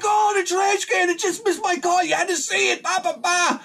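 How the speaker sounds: high, breathless excited rush; excited babble. Retold or imitated. Telling his wife about the near miss, high and excited. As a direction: gush like you can't believe what you saw.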